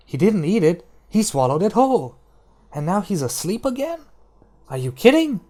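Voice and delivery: incredulous. Acted. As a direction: sound like you can't believe your eyes. Jane's incredulous thoughts, voiced.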